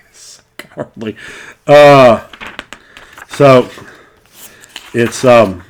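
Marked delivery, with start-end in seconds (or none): 0.0-1.1 laughing. Real, not acted. Laughing about cowardly barbarian.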